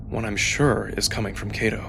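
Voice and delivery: ordinary delivery, nothing marked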